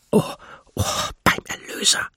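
startled hushed oath; breathy, suppressed exclamation; gasped whisper. A narrator's reading. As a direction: gasp it under your breath.

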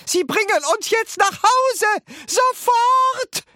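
very high, loud screeched demand; screeching. A narrator's reading. Grandma demanding to be taken home, screeching. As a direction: screech the order, building to a yell. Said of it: "kreischte Grandma Josephine".

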